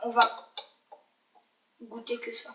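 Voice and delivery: usual speaking voice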